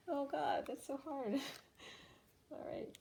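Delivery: tearful and high, voice catching; choked up. Real, not acted. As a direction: close to real tears. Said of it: "it's making me wanna cry".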